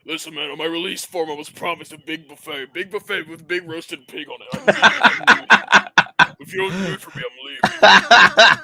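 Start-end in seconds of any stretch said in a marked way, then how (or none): 2.7-8.6 laughing mock demand. Acted buffet demand, laughing.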